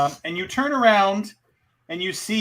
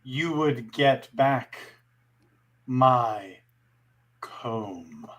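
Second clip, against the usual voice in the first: sultry narrator; 4 semitones lower, slower than usual. Acted. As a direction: purr it like a seductress. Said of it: "repeat it in your sultry voice".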